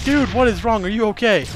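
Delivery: alarmed. Retold or imitated. Friends alarmed at panicking kid.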